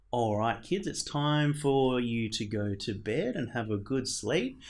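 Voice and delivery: ordinary delivery, nothing marked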